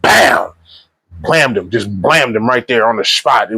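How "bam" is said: a sharp, high "bam" like a shot going off; mock gunshot. Retelling the movie's execution, a mock gunshot. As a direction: pop it out like a gunshot.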